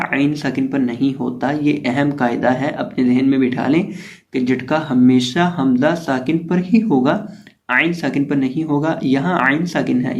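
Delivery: normal talking voice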